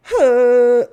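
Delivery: a loud, weary sigh out loud; a loud sigh of fatigue at the effort of makeup; heavy sigh. Real, not acted. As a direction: let out a big tired breath. Tired talk about makeup and sunscreen.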